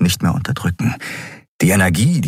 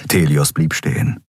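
normal talking voice

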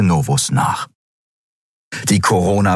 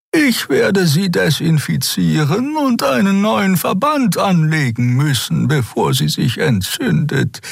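much higher, slow caring healer; caring Xea; 12 semitones higher than usual. A narrator's reading. Xea insisting on dressing Kero's wound, higher slow caring. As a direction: tend him gently and slowly.

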